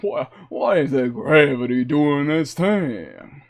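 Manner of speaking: Southern gentleman drawing out the question. Acted. Streamer showing off his voices, Southern gentleman accent. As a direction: put on a genteel Southern accent. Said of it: "uh, the Southern gentleman".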